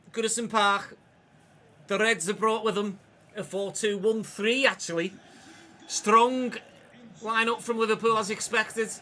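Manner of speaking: put-on Scouse accent for the match intro; amateur Scouse impersonation, slightly lifted. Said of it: "I won't do the Scouse accent for longer than just the intro".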